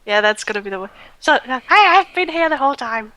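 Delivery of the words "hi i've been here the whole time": Chloe's voice. Acted. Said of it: "That's the voice Chloe has".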